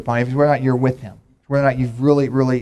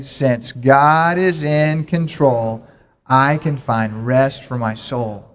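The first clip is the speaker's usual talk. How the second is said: even slower, stretched out, every word drawn long; slower, fuller recitation; much slower than usual. Shown as a demonstration. Pastor urging the congregation to say it better, slow emphatic chant. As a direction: say it better, really lean into it. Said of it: "Now we can say it better than that".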